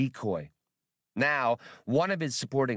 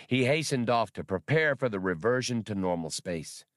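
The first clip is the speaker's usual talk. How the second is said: plain reading voice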